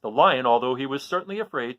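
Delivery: usual speaking voice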